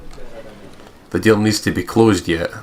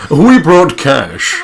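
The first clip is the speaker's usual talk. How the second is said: slow, high, loud boast; a hammed-up rich buyer; smug buyer; 8 semitones higher, 8 dB louder, much slower than usual. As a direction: lay the cash down proudly. Client voice bragging.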